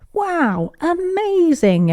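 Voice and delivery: high, slow amazed wow; amazed cheering. Acted. Everyone cheering the vanishing trick, high and amazed. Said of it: "everyone cheered".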